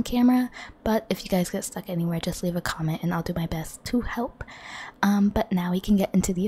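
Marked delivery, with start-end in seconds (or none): none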